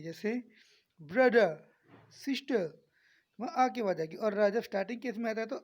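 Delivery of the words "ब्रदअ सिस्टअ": ब्रदर, सिस्टर ending on अ without R; silent final R. Shown as a demonstration. Teacher demonstrating silent R, both ending in a schwa. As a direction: drop the R at the end of ब्रदर and सिस्टर. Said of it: "अ की आवाज़ आती है".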